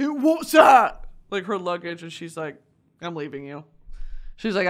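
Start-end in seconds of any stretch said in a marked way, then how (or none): 0.1-1.0 high, loud mock outburst; hammed-up alarmed demand; loud confronting question. Drunk man confronts her over luggage. 3.0-3.6 low-key icy announcement; quietly final; cold and dead. Retold or imitated. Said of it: "just the cold, dead stare".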